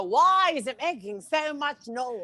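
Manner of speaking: put-on high, drawn-out old-timer voice; mock elderly complaint, stretched out; eighty-year-old accent. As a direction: imitate an old man, slowly and high. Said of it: "doing an eighty-year-old accent".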